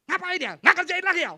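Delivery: loud high furious snap at a student; teacher shouting in mock fury; angry scolding. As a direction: blast the lazy student.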